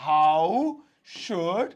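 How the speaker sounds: drawn out word by word; slow dictation. Shown as a demonstration. Teacher slowly reading the grammatical version to students. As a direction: pace it for the class to copy. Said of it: "ऐसे भी बना सकता हूं".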